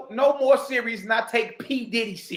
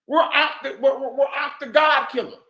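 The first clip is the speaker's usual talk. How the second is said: shouted, higher outburst; 4 semitones higher than usual. Real, not acted. Calling out the silent community with a shout. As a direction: yell it to the whole room.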